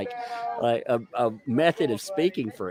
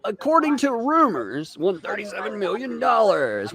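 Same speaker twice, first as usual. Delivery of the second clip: much higher, slowed news voice; mock TV reporter; 10 semitones higher than usual. Streamer as reporter outside courthouse, high mock news voice.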